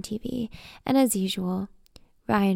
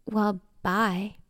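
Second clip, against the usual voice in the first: pissed. A narrator's reading.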